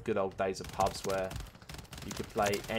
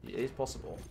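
ordinary talk

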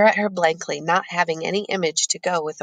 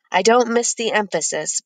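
ordinary delivery

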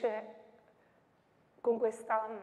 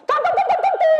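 much higher, louder tam tam hammered out; booming cannon sounds; 13 dB louder, faster than usual. Acted. Reciting the poem's cannon fire for students, shouted.

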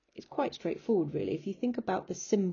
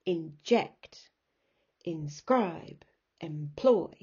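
inJECT, inSCRIBE, emPLOY; second-syllable stress; 4 dB louder, much slower than usual. Shown as a demonstration. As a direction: separate words, punching ject, scribe, ploy. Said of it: "It's the emphasized bit".

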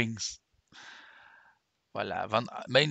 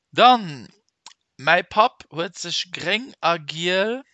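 loud question read; 6 semitones higher, 10 dB louder, slower than usual. Shown as a demonstration. Teacher reading the next question to the class, loud. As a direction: announce the next question loudly.

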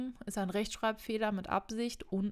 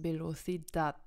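Velocidad with the stress on its final syllable, -dad; stress on last syllable; 2 semitones lower than usual. Shown as a demonstration. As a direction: put the beat at the back of Velocidad. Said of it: "also die Betonung ist da hinten". (Velocidad mit Betonung auf der letzten Silbe)

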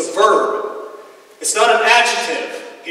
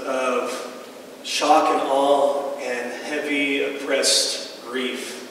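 heavy grief; 5 semitones lower, 7 dB quieter, much slower than usual. Real, not acted. Grief after a relative's death.

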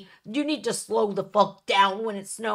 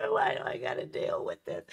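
normal talking voice

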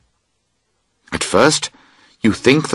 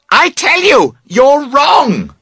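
very high, loud screamed insistence; screaming; 4 dB louder, slower than usual. A narrator's reading. Camper screaming at his friend in the rain, furious scream. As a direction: scream it at him in fury. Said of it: "you scream".

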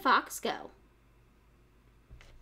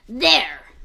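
loud, satisfied fox; 14 dB louder than usual. Acted. Fox proudly finishes fattening the piglet, loud. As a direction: announce it proudly. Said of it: "said the fox".

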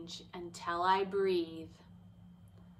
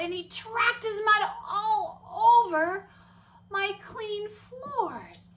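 higher and louder, building indignation; rising upset; 11 semitones higher, 7 dB louder than usual. Retold or imitated. Teacher retelling the muddy dog, rising upset. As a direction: let the frustration climb as the mud spreads.

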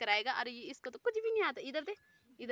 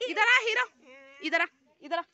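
higher, louder call to come over; 5 semitones higher, 10 dB louder than usual. Real, not acted. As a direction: beckon him across the yard. Calling a shy boy forward.

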